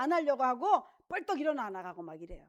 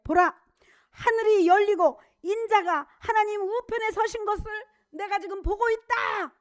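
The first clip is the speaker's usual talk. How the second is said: proclaiming cry; 9 semitones higher, 11 dB louder than usual. Acted. Stephen's cry quoted in sermon.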